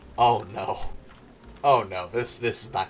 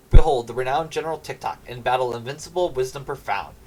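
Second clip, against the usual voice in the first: grand declaiming of a praise poem; recited poem; 4 dB louder than usual. Acted. Servant reading his poem to the lord, declaiming. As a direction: declaim it like an ode.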